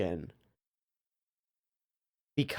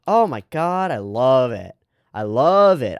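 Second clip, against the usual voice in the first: delighted; 2 semitones higher, 5 dB louder than usual. Real, not acted. Streamer loving the camera bounce, delighted. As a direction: gush with growing emphasis.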